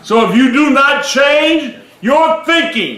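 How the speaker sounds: slow, high, shouted warning; preaching shout. Acted. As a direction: drive the point home. Preaching climax.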